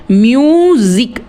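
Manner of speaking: म्यूजिक slowly in two beats, म्यू and जिक; syllable by syllable. Shown as a demonstration. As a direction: draw out म्यू, then place जिक separately. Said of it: "दो syllable word हो गया". (म्यूजिक को खींचकर दो हिस्सों में, म्यू-जिक)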